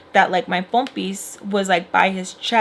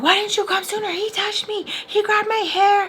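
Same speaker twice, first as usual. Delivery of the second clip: high-pitched complaint, imitating a yell; mock yell pitched way up without full volume; retold yelling; 12 semitones higher than usual. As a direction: accuse in a high, insistent retold outburst. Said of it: "And so I'm, like, yelling".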